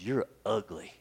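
deadpan the insult as the rude parrot; lightly voiced cheeky parrot, low and soft. Parrot voiced in told joke.

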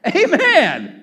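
loud, high preacher's amen; shouted amen. Real, not acted. Preacher capping his point to the congregation, shouting amen. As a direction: punch out a rousing amen.

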